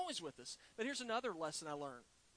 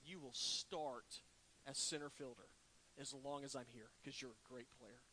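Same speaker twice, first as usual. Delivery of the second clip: reassuring manager; 6 semitones lower, 9 dB quieter than usual. Retold or imitated. Manager comforting crying player.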